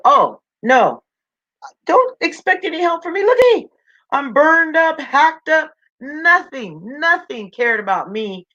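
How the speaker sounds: high, indignant rejection in character; bitter tree outburst. A narrator's reading. Burned, hacked oak turns away little bird, bitter. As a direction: voice leaps up high, complaining hard.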